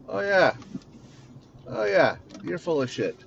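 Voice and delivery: high sarcastic oh yeah, falling; mock knowing scoff. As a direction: scoff at someone you see through. Voicing inner reaction to someone.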